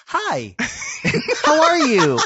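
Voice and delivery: high, loud mock letter-reader greeting; exaggerated inflection. Co-host teases Ian's question delivery, mimicking it high and exaggerated. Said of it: "puts on the inflection of their voices".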